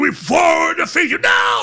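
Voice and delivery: high, drawn-out ceremonial yell; imitated screeching battle cry; screaming. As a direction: scream it like a ritual proclamation.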